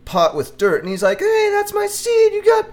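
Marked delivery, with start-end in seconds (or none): 1.2-2.7 very high, loud squeaky critter voice breaking into laughs; tree dude voice. Retold or imitated. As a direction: possessive creature squeal through laughter. Acting the little dude over seed.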